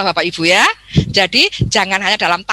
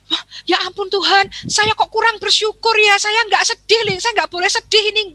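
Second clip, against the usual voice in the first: higher, mock-pious self-scolding; toxic positivity; 9 semitones higher than usual. Someone denies their own sadness, mock pious and higher. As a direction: force yourself not to feel sad. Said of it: "Jangan toxic positivity".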